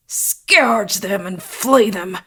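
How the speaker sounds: loud, drawn-out, harsh curse; savage relish. A narrator's reading. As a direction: stretch the words with vicious hatred. Villain repeats his vengeful curse.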